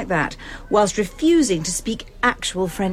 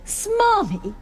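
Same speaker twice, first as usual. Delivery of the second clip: higher, drawn-out SMARMY; mounting indignation; 13 semitones higher than usual. Acted. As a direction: stretch out SMARMY in offended disbelief. Teacher repeats it after a pause.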